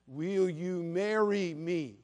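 each word drawn out, slow and deliberate; a very slow, pointed "Will you marry me?"; slow emphatic. Retold or imitated. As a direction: spell it out slowly like to a child. Spelling out the proposal slowly.

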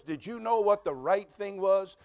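ordinary talk

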